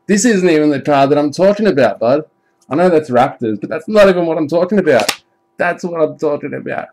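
shouting with glee at the pull; excited. Real, not acted. Pulling a big card on stream, loud excited shouting.

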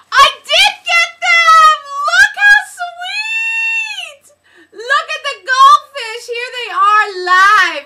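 very high, loud squeal; squealing excitement. Real, not acted. Host shrieks over the goldfish socks, very high and loud.

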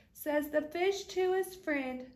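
usual speaking voice

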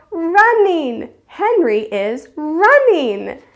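high, loud delighted shout of a guess; stretched-out, excited kid cheer; excited children guessing. Acted. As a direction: call it out like thrilled preschoolers. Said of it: "their friends will go".

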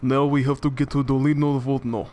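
put-on Russian accent, steady delivery. Shown as a demonstration. Player guiding co-op partner through the game, Russian accent. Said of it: "like, a good Russian accent".

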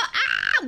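sudden yelp of alarm; short startled yelp; scream. Real, not acted. Scream on the slope.

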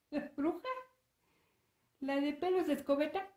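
high, rising disbelief; startled question. Acted. Toñito hears his sister mention the witch, startled high questions.

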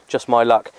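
ordinary delivery

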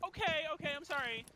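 high-pitched mock backing down; imitated hasty apology. Karen apologizes to lady ahead, high mock apology. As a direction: back off in a high voice.

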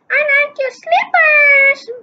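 slippers said high and loud; high character voice. Shown as a demonstration. Reader redoes the compliment, high and loud. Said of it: "Wait, no".

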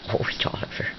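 hushed so the bird stays; whisper. Real, not acted. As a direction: whisper "Oliver" under your breath. Spotting the bird quietly.

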